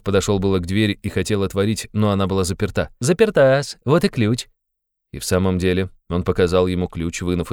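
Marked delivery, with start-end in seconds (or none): 3.0-4.5 high, teasing little announcement; playful interrogator. A narrator's reading. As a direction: present the fact with sly relish. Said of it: "становился всё веселее и игривее".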